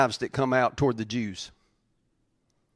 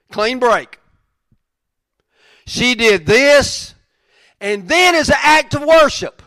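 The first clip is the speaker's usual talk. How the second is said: preaching at full force; 9 semitones higher, 9 dB louder than usual. Real, not acted. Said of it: "preaching at full force".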